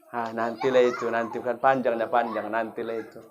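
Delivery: imitated listless procrastinator, low and soft; quiet, low brush-off, dying away; lazy putting-off. Worshipper postponing afternoon prayer to oneself, lazy putting-off. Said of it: "Ketika imannya turun, sholatnya pun diundur-undur".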